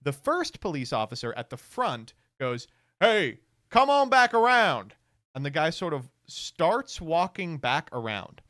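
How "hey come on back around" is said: retold call out. Cop calling partner back.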